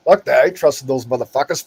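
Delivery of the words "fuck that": ordinary talk, nothing marked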